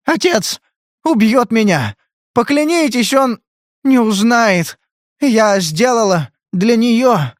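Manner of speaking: very high, halting plea, sinking at the end; frightened pleading. A narrator's reading. Drugged girl begging Spade for secrecy, frightened then fading. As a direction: beg in broken bursts, then give out.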